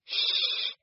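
soft unvoiced shush; hushing. A narrator's reading. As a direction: shush them in a whisper. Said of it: "said Gran".

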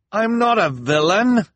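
high, slow, frantic plea. A narrator's reading. Jacques denying it to the crowd, frantic. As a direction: deny it desperately. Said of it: "Jacques said frantically".

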